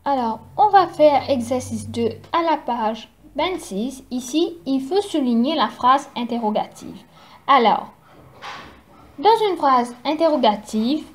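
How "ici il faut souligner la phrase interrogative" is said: normal talking voice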